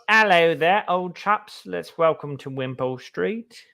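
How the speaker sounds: put-on toff British greeting to old chaps; British accent. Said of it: "I was doing some British accents".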